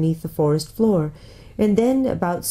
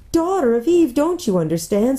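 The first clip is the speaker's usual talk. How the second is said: high, despairing appeal; anguished faun; 7 semitones higher than usual. A narrator's reading. Faun correcting Lucy, desperate. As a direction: plead for understanding, pitched up.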